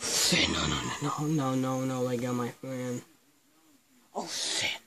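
hushed, panicked no no no; scared under the breath as they take a friend; frightened hush. Real, not acted. Intruders seize friend, hiding.

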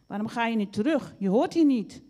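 loud, high, angry shout; calling out. A narrator's reading. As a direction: yell at the intruder to leave. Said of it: "riep Varkentje". (boos roepend)

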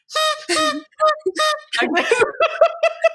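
bursts into a long high-pitched laugh; big delighted laugh, rising high. Real, not acted. Laughing at husband in party hat.

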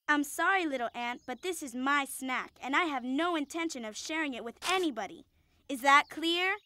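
usual speaking voice

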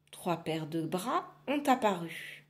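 usual speaking voice

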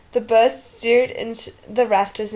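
plain reading voice

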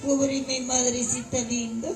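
lamento bajito por la madre; llanto fingido. Acted. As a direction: lloriquea muy quedo, con pena exagerada. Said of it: "¿Por qué lloras?". (pena fingida, en voz baja)

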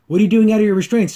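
lightly acted annoyed boss; mock scolding. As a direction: snap at the escaped prisoner. Imitating Catra scolding.

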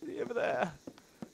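lightly voiced hammed-up Juliet; Juliet performance. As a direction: play Juliet on stage, high. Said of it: "that was a good rendition of Juliet".

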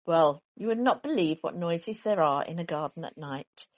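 usual speaking voice

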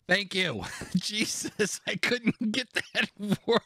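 high, giggly thanks; laughing. Real, not acted. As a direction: laugh through the words. Laughing at own flubbed word.

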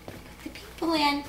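ordinary delivery

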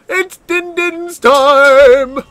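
high, drawn-out dinner call. Pretend. Poisoner calling the guests to dinner, high drawn-out call. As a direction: sing out slowly as if calling everyone in.

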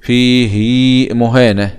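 فيه مهانة very slow, "fiihiii" stretched; haa with sila. Shown as a demonstration. Teacher summing up for students, فيه مهانة with stretched "hii". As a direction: hold the final vowel of فيه long before مهانة. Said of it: "بالصلة".

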